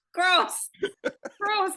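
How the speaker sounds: high, loud gross; disgusted. Real, not acted. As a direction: squeal it in disgust. Disgusted reaction.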